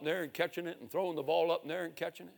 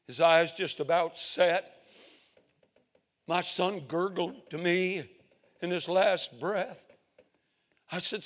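normal talking voice

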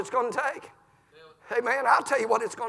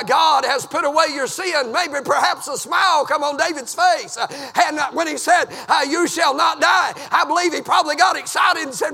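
loud, high preaching holler; full-force revival preaching; 7 semitones higher, 6 dB louder than usual. Acted. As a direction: hammer it home from the pulpit.

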